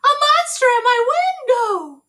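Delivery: high, loud frightened wail; terrified shout. Acted. Baby screaming about the monster at her window. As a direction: cry out in terror. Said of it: "shouted Baby".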